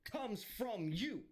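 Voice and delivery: usual speaking voice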